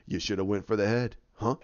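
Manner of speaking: low, lightly acted villain line; a hint of a low-voiced movie villain; retold dying taunt. Retelling Thor's fight to a listener, low acted quote. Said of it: "that's when he says".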